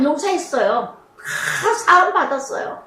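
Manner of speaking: usual speaking voice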